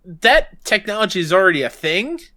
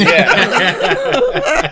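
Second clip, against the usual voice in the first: laughing; 9 semitones higher, 6 dB louder than usual. Real, not acted. Laughs at covid shot joke.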